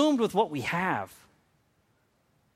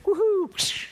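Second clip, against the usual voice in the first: high whooping cheer flying upward; gleeful whoop. Retold or imitated. Prophet leaping into the chariot, high joyful whoop. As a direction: whoop like a joyride into heaven. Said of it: "he's like".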